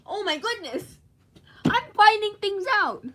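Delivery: high, loud excited exclamation; loud amazement. Real, not acted. Finding things out about the figure, loud amazed shout.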